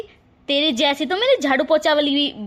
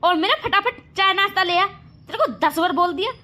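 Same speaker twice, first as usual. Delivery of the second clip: higher, sharp scolding orders; bossy scolding; 6 semitones higher than usual. Acted. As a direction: boss the younger daughter-in-law around. Elder sister-in-law orders younger.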